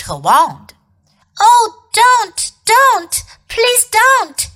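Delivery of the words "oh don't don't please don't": high, loud, desperate begging; a boy's urgent plea; pleading shout. A narrator's reading. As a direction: cry out to stop it, voice pitched way up. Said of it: "shouted Edmund".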